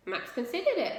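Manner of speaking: ordinary delivery, nothing marked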